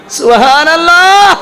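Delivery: high, slow, drawn-out praise; exalted exclamation. Acted. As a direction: lift it up in devotion. Preacher exclaiming in sermon. (উঁচু স্বরে ধীরে টেনে বলা)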